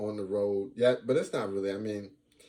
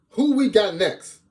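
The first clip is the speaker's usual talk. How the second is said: high, loud, drawn-out call; stretched high-pitched shout; hollered question; 11 semitones higher, 9 dB louder, slower than usual. Pretend. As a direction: holler it out to hype the crowd.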